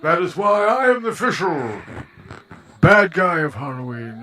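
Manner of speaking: loud villain proclamation; booming, theatrical bad-guy boast; Bane. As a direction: announce it grandly as the supervillain. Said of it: "I'm Bane".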